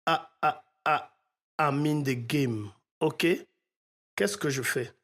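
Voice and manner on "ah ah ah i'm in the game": imitated stutter. Shown as a demonstration. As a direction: demonstrate a stuttered first syllable "Ah". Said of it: "trois bégaiements qui font". (trois « Ah » bégayés, bien détachés)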